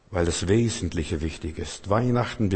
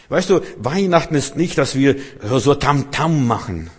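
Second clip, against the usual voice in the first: emphatic preaching; 5 semitones higher, 5 dB louder than usual. Acted. Preaching at full force.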